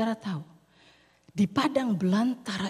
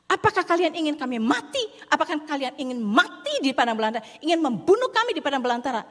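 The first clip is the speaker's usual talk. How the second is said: much higher, louder whiny complaint; grumbling complaint; 6 semitones higher, 6 dB louder than usual. Acted. Israelites grumbling at Moses about leaving Egypt, whiny and high. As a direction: whine at Moses like an ungrateful crowd. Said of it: "perkataan ini yang sudah mereka ucapkan".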